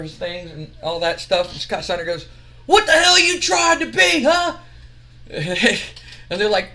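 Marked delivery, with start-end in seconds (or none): 2.7-4.6 much higher, louder hammed-up yell; angry shout. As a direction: bark it at them. Scott Steiner confronts Too Cool.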